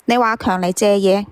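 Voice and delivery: ordinary delivery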